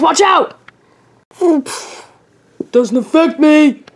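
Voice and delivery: rally the team in the chase; battle shouts. Acted. Team chasing Gengar, calling attacks excitedly.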